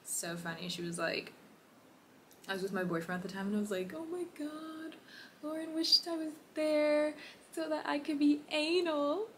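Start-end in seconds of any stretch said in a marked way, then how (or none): 3.9-9.3 high, gushing excited squeal; retelling her own emotional gush; all emotional. Retold or imitated. To her boyfriend about Lauren, a high emotional gush. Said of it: "all emotional".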